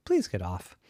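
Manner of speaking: a gentle giraffe asking nicely; polite giraffe. Acted. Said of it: "turned around and said".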